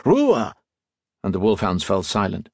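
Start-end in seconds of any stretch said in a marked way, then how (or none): 0.0-0.5 high-pitched sharp German order; contained shout, pitched way up; shouted command. A narrator's reading. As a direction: call the dogs to silence. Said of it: "Gaspar shouted".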